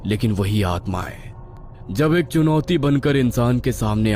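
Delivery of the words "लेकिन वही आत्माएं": ordinary delivery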